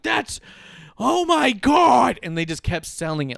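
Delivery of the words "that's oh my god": much higher, louder, drawn-out cry; mock shriek. Host mocking commentators still selling the blast, mock shriek. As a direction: oversell it like nothing's enough. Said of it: "they just kept going like".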